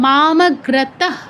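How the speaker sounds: মামগ্রতঃ very slow, ending in a short h breath; visarga pronunciation demo. Shown as a demonstration. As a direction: draw out মামগ্রতঃ and sound the final visarga as h. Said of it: "এরকম হতো". (মামগ্রতঃ ধীরে, শেষে হ-ধ্বনি দিয়ে)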